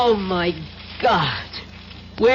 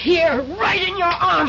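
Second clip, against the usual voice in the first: high, forceful cry as she strikes; fierce cry; 10 semitones higher than usual. Acted. Woman attacking the traitor with the syringe, shouting. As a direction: shout it as you drive the needle in.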